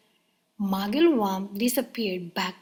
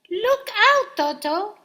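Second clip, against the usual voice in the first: high, loud warning shout; 11 semitones higher, 8 dB louder than usual. A narrator's reading. Hippo shouting a warning to the children. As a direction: yell a frantic warning. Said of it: "shouted Humpy Rumpy".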